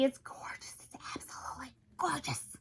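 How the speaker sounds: hushed, breathless gushing; soft, swooning whisper; awestruck whisper. Real, not acted. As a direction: whisper it in adoration. Swooning over the bag's print.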